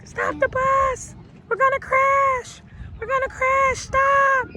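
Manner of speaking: high, loud panicked scream. Retold or imitated. Man on the bus screaming at the driver, high panicked yell. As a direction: scream it like the bus is about to crash. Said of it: "And he's screaming".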